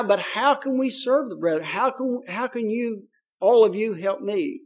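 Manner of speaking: ordinary talk, nothing marked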